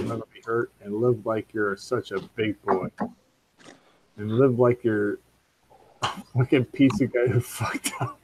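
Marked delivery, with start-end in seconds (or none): none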